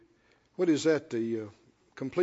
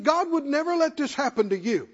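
insistent Peter; 6 semitones higher than usual. Retold or imitated. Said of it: "He said".

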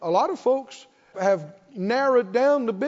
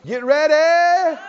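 rousing shout; 6 semitones higher, 7 dB louder, slower than usual. Acted. Preacher rousing congregation.

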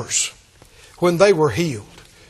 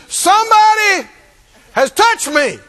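insistent Jesus; 9 semitones higher, 4 dB louder than usual. Retold or imitated.

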